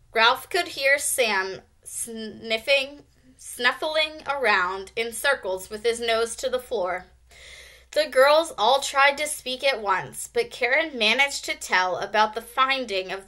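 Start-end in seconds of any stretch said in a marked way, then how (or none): none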